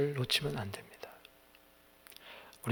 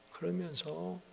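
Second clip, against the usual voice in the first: usual speaking voice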